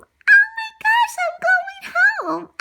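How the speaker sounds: high delighted cry, slow; high happy puppy relief; overjoyed. Acted. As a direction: gush with joy at going home. Lady taken home.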